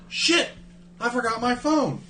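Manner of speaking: high-pitched mimicked dismay; dismayed realisation.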